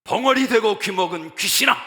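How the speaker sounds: Jesus rebuking the deaf and mute spirit, fierce shout; rebuking the demon. Acted. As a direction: thunder at the spirit. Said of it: "귀신을 꾸짖습니다".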